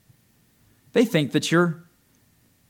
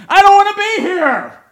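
much higher, louder pleading cry; spirit pleading; 13 dB louder than usual. Acted. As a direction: let the inner spirit beg to get out. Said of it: "it's your own spirit saying".

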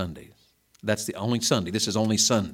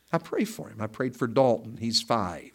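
normal talking voice

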